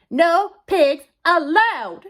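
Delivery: very slow, high, loud yell; shout. A narrator's reading. Eugenia yells the rule at the running pig, shouting. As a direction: shout each word separately. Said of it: "shouted Eugenia".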